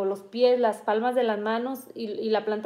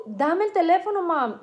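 higher-pitched imitation of an urgent demand; raised, insistent quote; retold urgent demand; 5 semitones higher than usual. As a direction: act out someone demanding the phone. Retold demand grabbing the phone.

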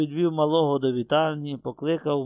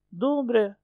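put-on sleepy child; 9 semitones higher than usual. A narrator's reading. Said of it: "відповіло сонне хлоп'я".

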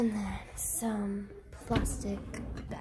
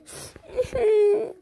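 put-on child sob; 9 semitones higher, 7 dB louder than usual. Doll child crying.

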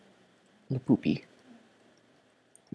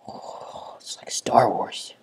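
awed whisper; slower than usual. Real, not acted. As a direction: whisper in wonder. Whispered awe.

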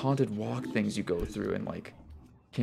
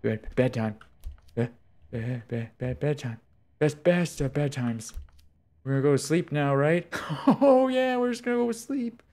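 mock bedtime singsong; 6 semitones higher, 6 dB louder than usual. Mock soothing bedtime to hallucinations.

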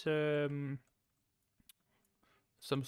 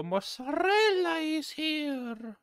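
high, slow sing-song server; hammed-up service voice; waiter voice; 12 semitones higher, slower than usual. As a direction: announce like a chirpy waiter. Serves customer in put-on voice.

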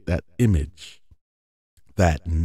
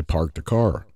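plain West Coast American accent, full r's: "Park the car" with clear r's; 4 semitones lower than usual. Shown as a demonstration. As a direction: say it like an LA local, every r sounded. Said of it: "if I go to L A".

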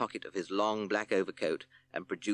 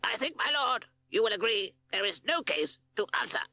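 higher, haughty crow lawyer; 3 dB louder than usual. Acted. Q C Crow defends the snake to the judge, haughty and higher. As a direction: sneer it like a smug lawyer bird.

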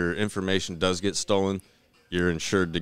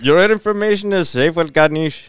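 loud, high mock Indian agent; hammed-up Indian accent, pitched up; 10 semitones higher, 9 dB louder than usual. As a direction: imitate an Indian agent vouching for safety. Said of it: "your Indian voice is awesome".

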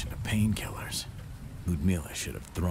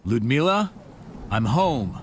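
much higher and louder call through the apartment; 6 semitones higher, 8 dB louder than usual. Acted. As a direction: call out for his sister into the silence. Calling out into empty apartment.

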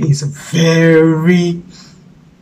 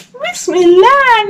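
high frog Bismillah; 14 semitones higher, 4 dB louder, slower than usual. Acted. Frog says it again.

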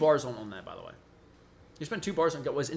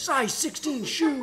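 higher, drawn-out game character cry; Sub-Zero; 5 semitones higher, 4 dB quieter, slower than usual. Acted. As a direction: slowly mimic a fighter's move call. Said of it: "That's Sub-Zero".

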